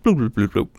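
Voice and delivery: bouncy bloop noises; bloop sound effect. Shown as a demonstration. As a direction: pop out little bloops. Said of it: "it could just be like".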